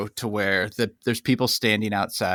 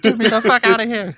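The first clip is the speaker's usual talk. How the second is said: mock desperate plea; 10 semitones higher than usual. Imagined hostage plea to manager.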